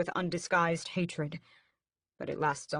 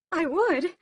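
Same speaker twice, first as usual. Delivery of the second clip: much higher, louder offended reply; scandalized; 13 semitones higher, 8 dB louder than usual. A narrator's reading. Mother scandalized at son's demand. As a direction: sound scandalized. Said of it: "sounding scandalized".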